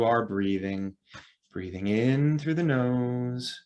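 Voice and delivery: calm, drawn-out breathing cues; guided meditation. Real, not acted. Instructor slowing listeners' nervous systems through breathing, guided meditation. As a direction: pace each phrase to the breath, leaving long pauses.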